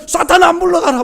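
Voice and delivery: loud, high commanding rebuke; bold rebuke. Retold or imitated. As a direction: drive Satan away with full force. Believer rebuking Satan boldly. (사탄을 꾸짖으며 크게 외치는 호령)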